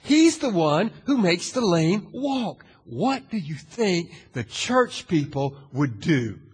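normal talking voice

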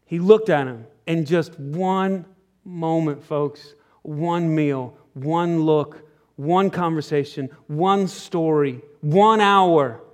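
soft, slow, measured list; slow hushed build. Real, not acted. Preacher to congregation on one meal, slow and hushed. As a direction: lay out each one slowly and softly.

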